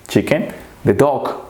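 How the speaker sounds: usual speaking voice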